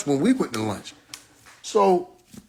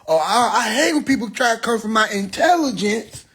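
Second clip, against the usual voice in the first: mock offended woman; 6 semitones higher than usual. Imitating the woman.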